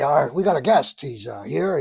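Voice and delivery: ordinary talk, nothing marked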